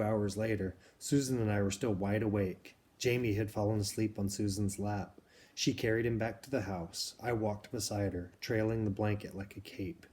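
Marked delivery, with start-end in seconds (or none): none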